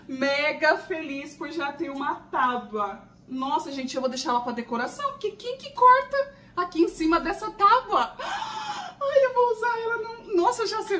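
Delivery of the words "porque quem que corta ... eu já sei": high laughing speech; giggly, high and fast; giggling. Real, not acted. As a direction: laugh through the words. Giggling over the cute board.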